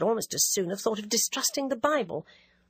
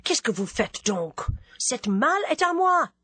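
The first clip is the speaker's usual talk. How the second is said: the Englishman switches to French, sharper on the second line; stranger speaking French; 4 semitones higher than usual. Acted. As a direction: correct the man in fluent French, rising at the end. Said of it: "Then, in correction".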